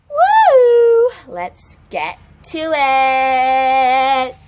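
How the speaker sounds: high loud whoop, then slow stretched line; excited cheer. Acted. Teacher hypes students for Spanish class, loud whoop. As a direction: hype the class up.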